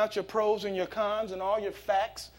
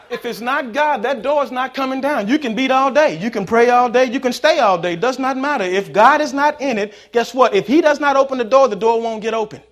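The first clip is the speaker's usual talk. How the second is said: loud, higher, driving preacher's cadence; preaching at full force; 5 semitones higher, 11 dB louder than usual. Acted. Pastor exhorting the congregation about closed doors, preaching loudly. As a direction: hammer each line to the congregation.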